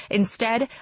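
ordinary delivery

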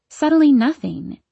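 plain reading voice

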